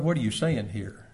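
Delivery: normal talking voice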